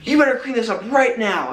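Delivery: imitating dad pretending to be furious; mock angry dad. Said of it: "he's just acting all pissed at me".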